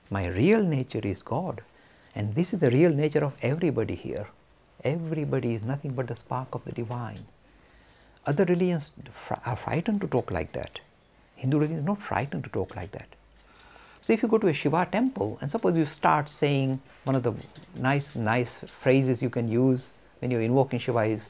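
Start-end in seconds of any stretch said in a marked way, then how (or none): none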